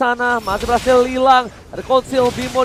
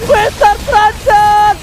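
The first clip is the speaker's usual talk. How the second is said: hype call; 6 semitones higher, 5 dB louder, much slower than usual. Real, not acted. Caster calls out player name.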